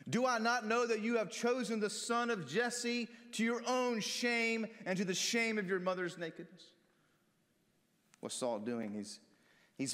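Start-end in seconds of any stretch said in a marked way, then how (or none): 0.1-6.7 accusing king. Acted. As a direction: hurl the accusation at his son. Saul's accusing speech to Jonathan.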